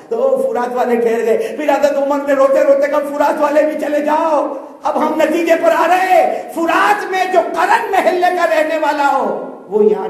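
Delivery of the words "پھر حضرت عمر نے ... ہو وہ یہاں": high, loud impassioned sermon voice, half laughing; full-force preaching. Acted. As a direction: cry the Prophet's words out to the crowd. Preaching at full force, Umar's call.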